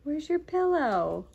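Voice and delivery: put-on slow, high sing-song to a toddler; reading to a toddler. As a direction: draw the little one into the game. Seek-and-find game with toddler.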